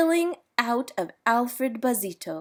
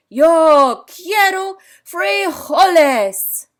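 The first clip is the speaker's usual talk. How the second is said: slow, loud, grave proclamation; somber declaration; 7 semitones higher, 11 dB louder, much slower than usual. A narrator's reading. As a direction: declare it like a solemn king. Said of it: "his most somber voice".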